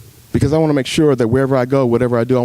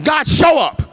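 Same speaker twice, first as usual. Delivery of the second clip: pleading shout; slower than usual. Retold or imitated. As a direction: cry out to God from the depths. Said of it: "'Cause I'm had to come".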